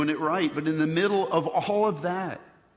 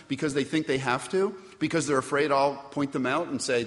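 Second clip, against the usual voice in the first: ordinary talk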